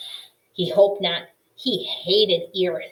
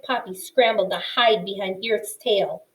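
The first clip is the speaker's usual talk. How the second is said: usual speaking voice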